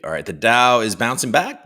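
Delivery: ordinary talk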